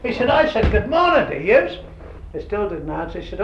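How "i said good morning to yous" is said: higher, pointed call of an offended boss; indignant boss. Retold or imitated. Old McKinnon to the silent workers, indignant and higher. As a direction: imitate the miffed supervisor demanding a reply.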